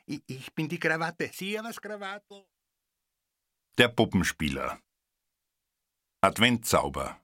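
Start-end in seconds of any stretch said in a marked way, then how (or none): 0.1-2.5 high, soft nervous stammer; the tie, scared to introduce itself; stuttering. A narrator's reading. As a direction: stammer it out timidly. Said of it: "stotterte ich".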